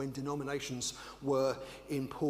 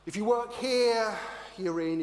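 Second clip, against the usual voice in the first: higher and louder, insistent; emphatic; 8 semitones higher, 7 dB louder than usual. Acted. Preacher declaiming the rules of the in crowd. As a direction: proclaim who is in. Listing who is in or out.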